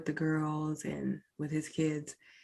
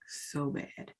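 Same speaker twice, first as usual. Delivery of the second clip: whispered hurt; 6 dB quieter than usual. Real, not acted. Grief, hurt trailing off.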